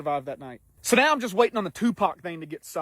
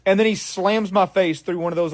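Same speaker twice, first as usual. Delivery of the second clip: ordinary talk, nothing marked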